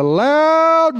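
LOUD stretched long, rising high; drawn-out LOUD. A narrator's reading. Preacher telling how Jesus cried out, LOUD drawn out high. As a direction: swell and stretch the word LOUD.

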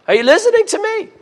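preacher jolting the congregation awake; excited call-out. Real, not acted. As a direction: fire up the listeners with a quick high challenge. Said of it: "calling out to congregation".

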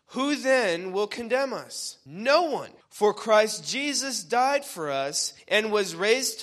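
preacher proclaiming the verse with rising conviction; forceful scripture preaching. Acted. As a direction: drive each question out to the congregation.

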